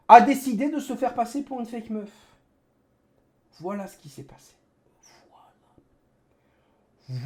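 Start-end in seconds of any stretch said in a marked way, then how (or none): 5.3-7.2 breathy whispered aside; whispering. Real, not acted. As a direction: drop to a whisper.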